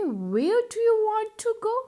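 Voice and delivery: drawn-out lifted question; teacher modelling the question slowly. Shown as a demonstration. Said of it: "कैसे पूछोगे?". (धीमा, ऊँचा सवाल)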